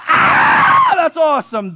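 huge high shout of triumph; all-out fan roar; screaming celebration. Retold or imitated. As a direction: erupt like your team just scored. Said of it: "you're screaming with all your might".

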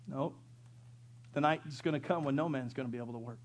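ordinary talk, nothing marked

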